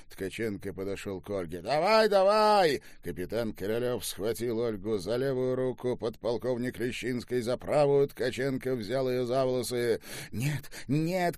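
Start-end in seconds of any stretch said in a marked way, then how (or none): 1.6-2.8 loud, high, drawn-out command; shouted order. A narrator's reading. General sets his officers on the prisoner, shouted command. 10.3-11.4 cried-out protest. A narrator's reading. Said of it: "кричала Ольга".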